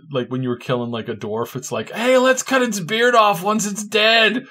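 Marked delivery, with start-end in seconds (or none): 1.9-4.4 high, slow, louder put-on voice; talking sword. Talking sword urging player over dead dwarf, high gleeful voice. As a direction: relish it like a gleeful blade. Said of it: "You had a talking sword".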